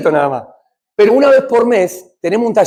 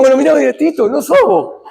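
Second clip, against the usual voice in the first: laughing tease; 7 semitones higher than usual. Retold or imitated. Friends teasing Tito, laughing as they speak. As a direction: rib a friend while cracking up.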